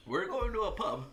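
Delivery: high, loud mock-British cry; bad English accent. Co-host planning a pub visit, loud fake English accent. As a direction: announce it as a cartoon Englishman. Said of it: "We're terrible at your accent".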